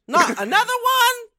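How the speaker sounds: high, laughing mock whine; imitating Brenda from Bristol. Recalling Rita Baga wins, doing a high mock Brenda. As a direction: squeak it out, fed up. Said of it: "Brenda from Bristol".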